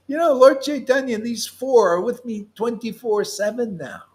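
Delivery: a hint of Maria's high amazed voice; imitating Maria. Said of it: "And she says".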